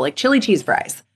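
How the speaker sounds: ordinary talk, nothing marked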